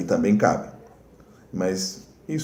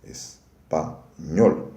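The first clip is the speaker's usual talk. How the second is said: "espanhol" very slowly, broken as es, pa, nhol; syllable-by-syllable demo; 5 semitones lower, much slower than usual. Shown as a demonstration. Teacher showing the tonic is last, "espanhol" in slow separate syllables. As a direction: split "espanhol" into three spaced syllables, lower pitch. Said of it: "a sílaba tônica tá na última".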